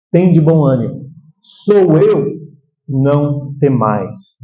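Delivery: measured, comforting quote, slowing at the end; calm reassurance. Acted. Preacher voicing Jesus to troubled hearts, calm and reassuring. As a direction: let each phrase settle, easing down slowly.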